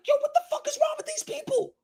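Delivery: very high, squeaky disbelief; incredulous. Retold or imitated. Reacting to the channel's viewers, very high disbelief. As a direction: squeeze it out in total disbelief. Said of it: "I'm like".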